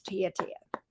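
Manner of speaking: breathy whisper of her name. Real, not acted. Calling on the cards.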